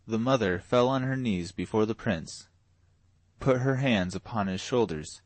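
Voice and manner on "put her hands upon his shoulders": ordinary delivery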